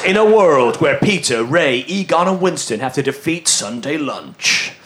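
low, dramatic trailer read; deep epic announcer; movie trailer voice. Pretend. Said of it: "use the movie trailer voice".

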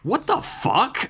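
high, slow disbelief; stunned high-pitched exclamation; shock. A narrator's reading. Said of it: "leaning back from the phone in shock".

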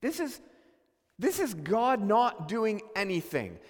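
normal talking voice

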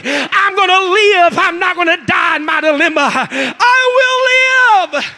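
loud, rising cry of faith; shouted proclamation. Retold or imitated. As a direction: shout it out, breaking into a cry at the end. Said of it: "shout it with me".

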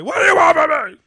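high desperate shout; movie line shout. Retold or imitated. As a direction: yell it like the film. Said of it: "Some iconic lines is like".

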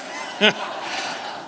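soft breathy chuckle; airy laugh. Real, not acted. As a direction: let a quiet laugh escape. Laughs at his own joke.

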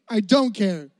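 ordinary delivery

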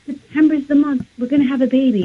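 put-on bubbling over with news of a baby; excited husband. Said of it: "He was so excited".

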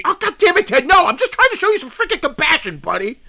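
high, frustrated protest; exasperated outburst. Acted. Host protesting to the guest, exasperated. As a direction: protest that he's only being kind.

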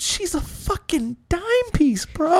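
high-pitched disbelieving protest; mock incredulous friend. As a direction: imitate a buddy who can't believe it.

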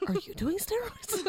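high, amused quote; lightly voiced dad line; mock TV dad question. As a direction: tease it like the show's dad. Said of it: "I just like the way he says it".

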